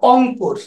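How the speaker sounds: অংপুর said with no r at the start; Rangpur dialect word. Retold or imitated. Teacher showing how Rangpur locals say অংপুর. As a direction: drop the r: অংপুর. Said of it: "ওরা রংপুরকে নিজেরাই রংপুর বলে না".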